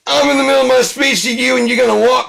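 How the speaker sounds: high, fast, full-volume shout; furious yelling. Acted. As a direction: yell after someone walking away mid-speech. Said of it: "It's really loud".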